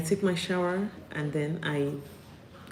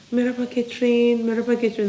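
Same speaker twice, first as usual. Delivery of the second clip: lightly voiced greeting teachers, higher; imitating cheerful teachers; 7 semitones higher, 5 dB louder, faster than usual. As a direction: call out merhaba like a happy colleague. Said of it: "That means".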